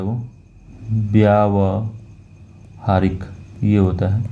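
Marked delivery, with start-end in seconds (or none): 0.9-3.2 व्यावहारिक said slowly with a long व्या; slow clear pronunciation. Shown as a demonstration. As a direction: model व्यावहारिक slowly for students. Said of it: "शुद्ध रूप है व्यावहारिक".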